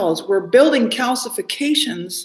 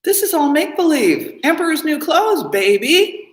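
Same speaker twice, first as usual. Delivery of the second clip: higher, louder mocking disbelief; retold mocking incredulity, pitched up and louder; incredulous scoff; 8 semitones higher, 4 dB louder than usual. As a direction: scoff at the make-believe. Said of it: "I told them, I'm like".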